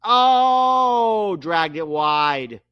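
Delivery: high, drawn-out oh, then slow; live call. Real, not acted. Fan calling Messi's missed chip live. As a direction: call the miss like a commentator.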